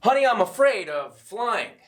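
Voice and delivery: loud, high announced line; bad acting. Shown as a demonstration. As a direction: declare it out loud like news. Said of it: "here's the difference".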